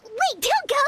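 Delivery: high, urgent call after her; sudden high-pitched plea. Acted. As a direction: plead for her to stay. Paimon calls after leaving guest.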